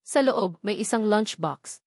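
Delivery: ordinary talk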